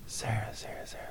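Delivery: ghostly whispered calling; eerie whisper. Retold or imitated. Ghostly voice calling her name.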